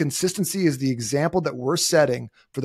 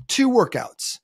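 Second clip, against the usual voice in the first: high, excited boast; eager new convert; 10 semitones higher, 3 dB louder, slower than usual. Acted. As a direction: proudly blurt it out, pitched way up.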